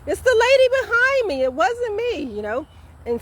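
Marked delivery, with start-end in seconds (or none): 0.1-1.4 high, loud call, not angry; calling out to the woman, loud but not angry; friendly yell. Retold or imitated. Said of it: "not in an angry voice". 1.4-2.2 friendly yell. Retold or imitated. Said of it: "not in an angry voice".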